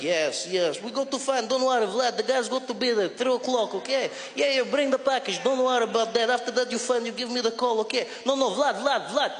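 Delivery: mock Russian accent on a phone call; Russian-accented English. Russian guy on the phone to Vlad, mock accent. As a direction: play a Russian thug setting up a deal. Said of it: "they were just there like".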